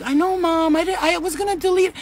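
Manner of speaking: high-pitched mimicked kid excuse; protesting daughter. Daughter to her mother about deleting, protesting.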